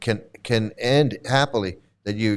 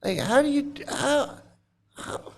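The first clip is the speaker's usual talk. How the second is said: high, fading how; mock astonishment that trails off; stunned disbelief; 10 semitones higher, 4 dB quieter, slower than usual. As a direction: gasp out the question in shock. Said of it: "I was like".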